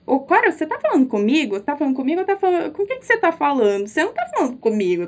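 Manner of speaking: fast, disbelieving rattle; incredulous rush. Retold or imitated. Telling Cora she can't mean her, fast disbelief. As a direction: rush it as if you can't believe it. Retold incredulous reply to friend.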